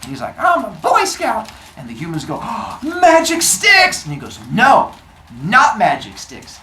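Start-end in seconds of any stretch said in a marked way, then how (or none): 0.4-1.5 proud boast. Acted. Prometheus brags. 2.4-4.0 much higher, louder, slow amazement; hammed-up wonder; awed gasp. Humans amazed by fire. 4.5-6.6 firm correction. Acted. Prometheus corrects humans.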